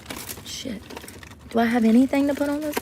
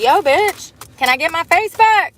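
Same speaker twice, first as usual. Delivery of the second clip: higher and louder, mock-sassy snap; sassy retort; 7 semitones higher, 4 dB louder than usual. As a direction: throw it back with attitude. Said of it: "I'd be like".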